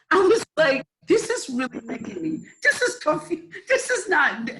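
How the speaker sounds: high-pitched words broken by real laughter; laughing through words. Real, not acted. Laughing while recalling tears.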